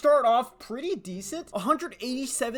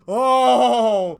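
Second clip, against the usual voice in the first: surprised; 2 semitones higher, 13 dB louder than usual. Real, not acted. Surprised exclamation.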